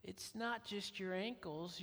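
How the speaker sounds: lightly voiced little girl, innocent; little niece. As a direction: niece piping up from the back seat, softly. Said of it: "sticks her head up ... and says".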